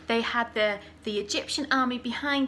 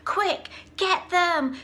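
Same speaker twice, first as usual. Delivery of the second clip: higher, louder order to chase; shouting; 7 semitones higher, 4 dB louder, slower than usual. Acted. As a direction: urge the army on. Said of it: "So he was shouting".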